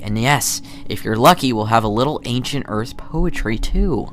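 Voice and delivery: normal talking voice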